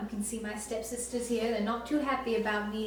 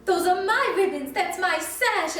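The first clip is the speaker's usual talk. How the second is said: high, loud, slow shriek; stepsisters shrieking; 8 semitones higher, 6 dB louder, slower than usual. A narrator's reading. Stepsisters shrieking at Cinderella, high and loud. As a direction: screech it like a jealous stepsister. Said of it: "The stepsisters shrieked".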